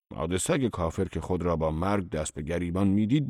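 plain reading voice

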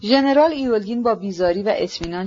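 plain reading voice

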